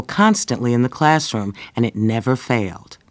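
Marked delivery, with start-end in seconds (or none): none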